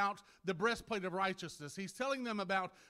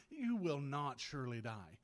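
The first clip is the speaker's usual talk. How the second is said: low, slow, hushed tempter; devil tempting Eve; 6 semitones lower than usual. Acted. Devil answering Eve in the garden, low persuasion.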